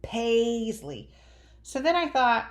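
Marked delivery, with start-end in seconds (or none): 0.0-1.1 PAISLEY with its first vowel drawn out slowly; long A stretched. Shown as a demonstration. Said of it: "long and strong in those names, like".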